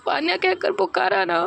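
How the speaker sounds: high, choked voice close to crying; tearful daughter. Acted. Daughter opening her heart to her mother, high and tearful. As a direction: pour it out through tears.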